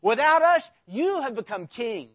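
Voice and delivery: loud, mocking sarcasm; sarcastic. Preacher mocking the proud Corinthian church, sarcastic. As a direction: throw it at them with biting sarcasm. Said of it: "Do you hear the sarcasm in these words".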